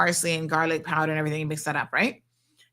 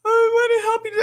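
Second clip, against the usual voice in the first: put-on crying child; 14 semitones higher than usual. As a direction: beg through tears like a small girl. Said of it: "She was like".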